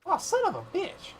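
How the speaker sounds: sudden high yelp of surprise; real surprise, pitch shooting up; startled outburst. Real, not acted. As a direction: jump at something unexpected. Startled by unexpected find.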